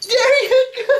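loud, drawn-out happy praise; excited praise. Real, not acted. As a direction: gush over the dog. Dog jumps again, praise.

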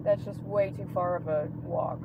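ordinary talk

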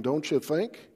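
ordinary talk

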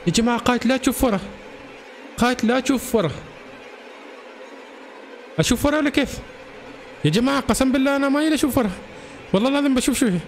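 high, anxious appeal to viewers; spooked, high-pitched hesitation; nervous panic. Real, not acted. Player warned not to look back.

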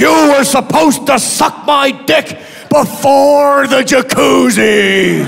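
slow, drawn-out indignant ranting; slow mock Mel Gibson rant. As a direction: drag every word out like an aggrieved ranter. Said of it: "And he goes".

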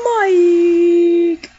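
loud call out; yelling a name. Real, not acted. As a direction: shout the name. Calling the character.

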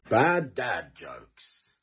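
drawn-out title announcement; slow show title. Acted. Title announcement of each joke.

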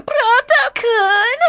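high, slow, drawn-out cheer; high drawn-out mock cheer; mock excited call. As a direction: call the name out like a squealing fan. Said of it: "everyone's going".

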